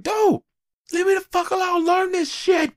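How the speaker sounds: high-pitched exasperated outburst; mock angry at daughters. Dad snapping at his daughters, high mock exasperation. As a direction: squeal it like a fed-up dad. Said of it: "getting real pissed at my daughters".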